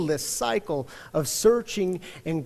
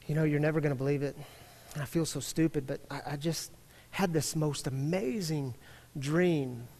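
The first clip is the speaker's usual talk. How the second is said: softer, fast embarrassed admission; sheepish confession; 2 semitones lower, 7 dB quieter than usual. Retold or imitated. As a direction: mumble it, ashamed of yourself. Rabbi sheepishly confesses to officer.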